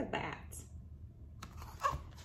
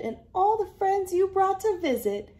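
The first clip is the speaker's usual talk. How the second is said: higher, louder happy granny; joyful granny; 9 semitones higher, 4 dB louder than usual. Acted. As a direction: celebrate with everyone. Granny celebrates.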